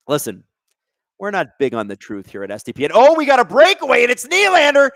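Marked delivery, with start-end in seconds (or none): 2.9-4.9 higher, louder play-by-play burst; sudden rush of hockey commentary; excited breakaway call. Real, not acted.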